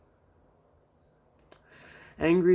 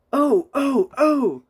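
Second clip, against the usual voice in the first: alarmed cry; 13 semitones higher, 9 dB louder, much slower than usual. A narrator's reading. Henry hit by stones.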